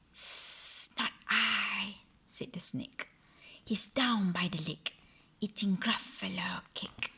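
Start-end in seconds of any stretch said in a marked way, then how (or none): 0.1-2.0 unvoiced snaky whisper with a long hiss; whispered serpent voice; hissing snake. The snake answers. 3.6-7.1 unvoiced snaky whisper with a long hiss; whispered serpent voice; hissing snake. The snake answers.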